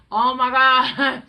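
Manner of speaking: loud slow gasping oh my God; horrified gasp. Real, not acted. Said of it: "so bad".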